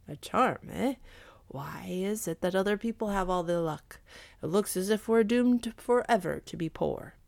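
put-on husband's voice with swooping pitch; grumble, then reason out the borrowing; animated, calculating character voice; scheming Mister Chu. Mister Chu figure in narrated tale.

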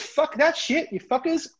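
high-pitched mock outburst; acted defiance. Crewman refusing to pay out the crew, acted defiance. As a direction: throw it out high as a cheeky kiss-off.

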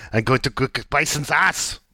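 mock Austrian action-hero accent; Arnie. Quoting Colonel Guile in Street Fighter, mistaken Austrian accent. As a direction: put on a rough attempt at an Austrian accent. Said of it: "I think I did an Arnie accent".

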